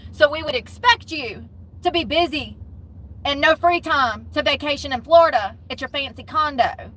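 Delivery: ordinary talk, nothing marked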